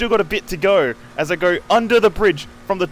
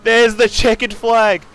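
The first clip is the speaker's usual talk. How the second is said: rising excitement; 7 semitones higher, 4 dB louder than usual. Real, not acted. Final corners of twelve-hour race.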